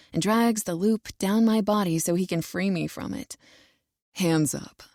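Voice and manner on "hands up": order. A narrator's reading. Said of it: "he orders".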